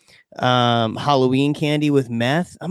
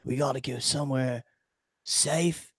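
hushed; 5 semitones lower, 10 dB quieter than usual. Retold or imitated. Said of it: "they were like".

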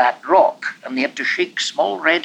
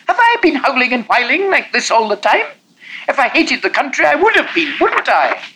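put-on whining boy; 13 semitones higher, 6 dB louder than usual. Boy keeps whining back.

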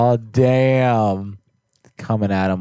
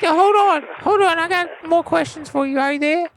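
very high cartoon rapper voice; Lil P P voice. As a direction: talk like a helium-pitched rapper. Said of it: "I'll put him on the phone".

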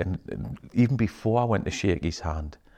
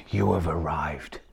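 solemn leader; 3 semitones lower, 4 dB quieter than usual. Retold or imitated. Retold leader's solemn words.

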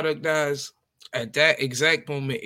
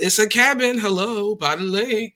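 emphatic; 5 semitones higher, 4 dB louder than usual. Real, not acted. Mocking emphasis on lake house.

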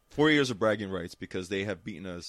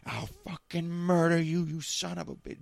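high, mock-furious threat; imitated mad woman; 8 semitones higher than usual. Mad Jets fan threatening a heckler, imitated fury. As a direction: play an enraged fan, pitched up and stretched out. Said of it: "She gets mad".

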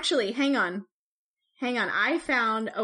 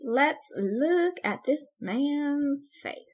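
dramatic slow announcement; 3 semitones higher, much slower than usual. Acted.

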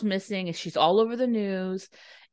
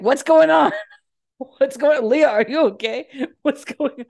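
high, loud worried dispatcher calling; hammed-up alarmed shouting into the phone; frantic calling out; 7 semitones higher, 7 dB louder than usual. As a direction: call out urgently to a silent line.

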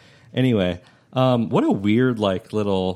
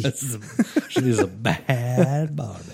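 laughing; 3 semitones higher, slower than usual. Real, not acted. Laughing at the nickname.

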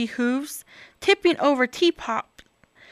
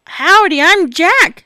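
cheerful Jack; 6 semitones higher, 9 dB louder than usual. Acted. Cheerful cowboy greeting.

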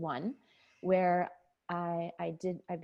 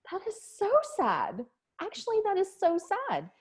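tearful realization; 13 semitones higher, 3 dB louder than usual. Retold or imitated. Realizing her life is sad.

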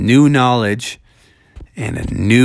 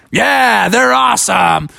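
enthusiastic Bubby; 8 semitones higher, slower than usual. A narrator's reading. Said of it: "And he's like".

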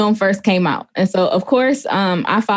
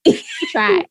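high pitying. Retold or imitated. Pitying the character.

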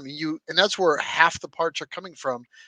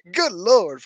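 louder, higher mock exclamation; mock shock; 5 semitones higher, 8 dB louder than usual. Retelling the parts buyers' sticker shock, exclaiming in mock shock. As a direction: act out shocked disbelief, pitched up. Retold shocked reaction to prices.

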